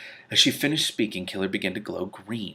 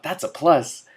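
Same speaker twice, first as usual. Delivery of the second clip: high, loud catty retort; 7 semitones higher, 6 dB louder, slower than usual. Acted. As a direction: point it out in a high, bright cat voice. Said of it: "Trouble pointed out".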